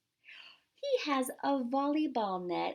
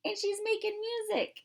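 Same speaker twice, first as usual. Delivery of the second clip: excited; 12 semitones higher than usual. Real, not acted. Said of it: "marvels at picture".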